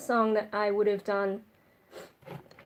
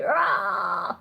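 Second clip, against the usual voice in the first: soft raspy fry yell; quiet gritty scream; 3 semitones lower than usual. Shown as a demonstration. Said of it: "that sort of quiet Fry scream".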